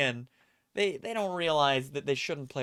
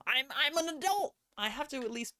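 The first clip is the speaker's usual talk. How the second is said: high indignant; 11 semitones higher than usual. Real, not acted. Mock indignant exclamation.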